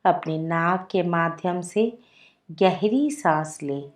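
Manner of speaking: soft, unhurried meditation voice with long pauses; guided meditation. Acted. Leads a guided relaxation meditation. (धीमी, शांत ध्यान निर्देश की आवाज़)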